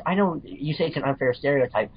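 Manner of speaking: ordinary talk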